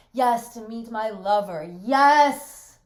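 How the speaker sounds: very loud, drawn-out defiant cry; slow screaming defiance; defiant shout. Acted. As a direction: throw it in his face. Bertha hurls back the accusation.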